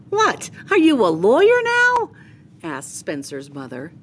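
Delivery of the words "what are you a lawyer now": much higher, loud amused disbelief; incredulous. Acted. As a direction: scoff at the little negotiator. Mom startled by his bargaining.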